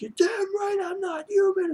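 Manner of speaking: very high, strained mock outburst; flipping out. Shinichi snapping back at his accuser, hammed-up high-pitched flip-out. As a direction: imitate someone losing it in a squealed-up cry. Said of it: "Just flips out".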